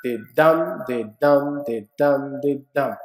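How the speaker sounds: da DUM da DUM, stress on each dum; iambic beat. Shown as a demonstration. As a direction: tap out the iambic rhythm. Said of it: "this rising and falling is called iambic".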